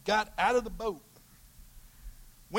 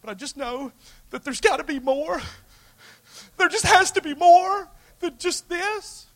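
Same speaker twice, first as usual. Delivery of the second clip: pleading cry; 10 semitones higher, 8 dB louder than usual. Acted. Voiced believer pleading to Lord.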